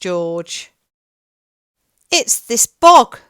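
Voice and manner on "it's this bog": higher, much louder upset dog; dog's voice. A narrator's reading. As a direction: howl the complaint as the dog. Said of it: "said the dog".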